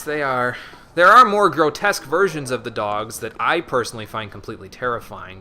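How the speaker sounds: normal talking voice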